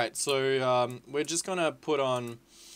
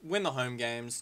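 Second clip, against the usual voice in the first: usual speaking voice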